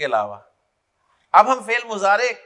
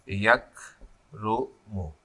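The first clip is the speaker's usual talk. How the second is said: "یکرمو" very slow, lower and softer, paused between syllables; 11 semitones lower, 7 dB quieter, much slower than usual. Shown as a demonstration. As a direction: dictate "یکرمو" in separated, drawn-out pieces as you write. Dictating while writing the word.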